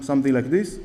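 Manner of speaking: usual speaking voice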